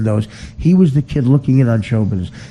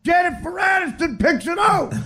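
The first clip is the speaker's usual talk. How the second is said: much higher shouted bets, laughing through; mock caddie shouting bets. Comic mocking celebrities with caddie betting shouts. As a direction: holler the wagers like a rowdy caddie. Caddyshack bet parody.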